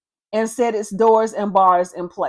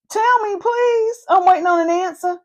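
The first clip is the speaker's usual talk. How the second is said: sarcastic God; 7 semitones higher than usual. Acted. God taunting Job, high and mocking. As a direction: tease Job for the answer he can't give.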